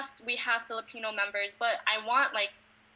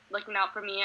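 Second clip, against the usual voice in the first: ordinary talk, nothing marked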